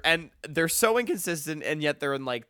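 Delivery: ordinary delivery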